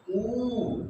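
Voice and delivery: ऊ stretched out slowly as a long vowel; long drawn ऊ. Shown as a demonstration. Teacher to children, showing the long ऊ slowly drawn out. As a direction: hold the ऊ long and slow. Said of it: "इसका ठीक उल्टा".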